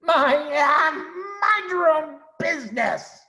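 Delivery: high, loud, scolding; angry shriek. Acted. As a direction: drive the clumsy intruders off. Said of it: "shrieked Rat".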